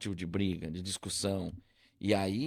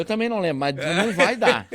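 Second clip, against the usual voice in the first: laughing; 7 semitones higher, 7 dB louder than usual. Retold or imitated. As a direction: laugh through a lame excuse. Acting evasive to friends, laughing.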